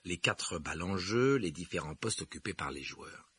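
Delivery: usual speaking voice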